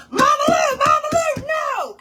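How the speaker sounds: high, loud frantic scolding; shrieked reenactment of panic; retold yelling. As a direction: yell to stop the cat mid-act. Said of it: "I'm yelling".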